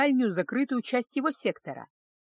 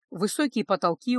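plain reading voice

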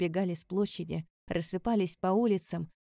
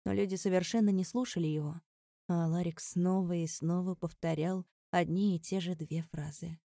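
usual speaking voice

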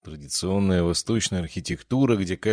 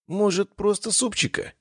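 pleading; 13 semitones higher than usual. A narrator's reading. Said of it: "умоляюще спросил он".

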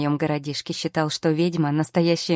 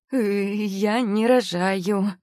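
very slow, higher, squeezed out; forced out; 5 semitones higher, much slower than usual. A narrator's reading. Pregnant girl squeezing out a denial. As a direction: strain to get the words out. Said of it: "выдавила из себя девушка".

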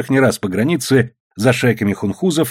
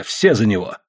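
ordinary delivery

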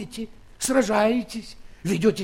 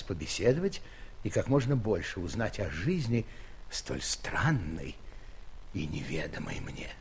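musing softly; 11 semitones lower, 8 dB quieter than usual. Acted. King musing on strange life.

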